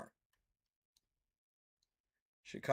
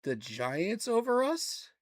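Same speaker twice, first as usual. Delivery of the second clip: higher and slow, stretched in disbelief; incredulous, drawn out; 7 semitones higher, slower than usual. Real, not acted. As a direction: let the question hang, outraged and amazed. Outraged at ESPN ranking his team.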